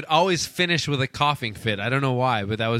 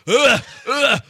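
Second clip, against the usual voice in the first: higher, louder hacking coughs; 8 semitones higher, 5 dB louder than usual. Retold or imitated. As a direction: imitate a harsh cough fit. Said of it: "culminate in him going".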